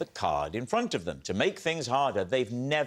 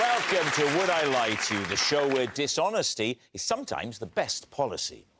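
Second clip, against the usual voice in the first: upbeat TV panel-show introduction; show host announcer; 8 semitones higher, 3 dB louder than usual. Acted. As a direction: lift each guest's name for applause.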